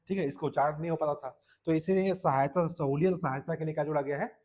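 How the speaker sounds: ordinary talk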